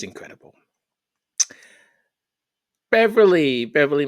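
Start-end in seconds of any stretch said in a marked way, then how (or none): 2.9-4.1 calling out. Real, not acted. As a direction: sing the name out loud and high. Calling out a commenter's name.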